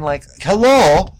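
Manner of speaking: drawn-out, high, loud hello; mock the obvious, stretch it out; exasperated, slow "hello"; sarcastic hello. Real, not acted.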